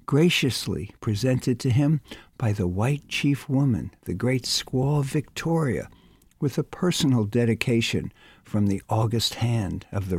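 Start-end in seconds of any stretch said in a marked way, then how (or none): none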